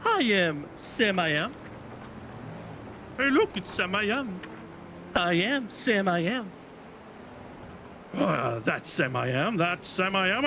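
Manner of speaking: hammed-up Dr. Seuss read-aloud; reading to children. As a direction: bounce through the rhyme like a kids' story hour. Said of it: "we've got to do a Doctor Seuss read a book".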